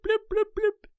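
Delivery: high-pitched blip noises; notification blips. Retold or imitated. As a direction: mimic the phone pinging. Imitating phone notification.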